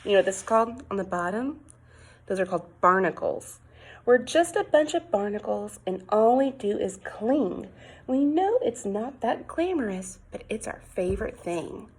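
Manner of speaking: ordinary talk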